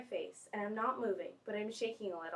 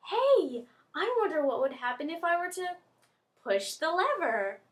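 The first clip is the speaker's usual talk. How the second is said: higher, rising playful wondering; playful curiosity; 5 semitones higher, 5 dB louder than usual. Retold or imitated. Student tempted by a lab lever, playful curiosity. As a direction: wonder aloud as an idea strikes. Acts out her naughty curiosity.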